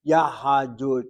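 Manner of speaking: "yahdut" opened out to three syllables, ya-ha-dut; pronunciation as heard. Shown as a demonstration. Teacher giving the form people heard, "yahdut" said as ya-ha-dut. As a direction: say "yahdut" slowly with an extra "a" after the h. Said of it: "was what was heard".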